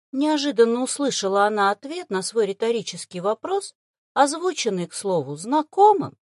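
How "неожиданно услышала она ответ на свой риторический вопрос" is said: ordinary delivery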